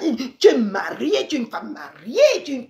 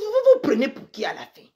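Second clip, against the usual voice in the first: ordinary talk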